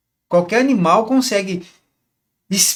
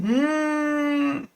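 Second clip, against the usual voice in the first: long, high, held skeptical "hmm"; sarcastic hum; 8 semitones higher, 5 dB quieter than usual. Shown as a demonstration. Coach adds the reaction behind a fake compliment, sarcastic hum. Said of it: "Tipo assim".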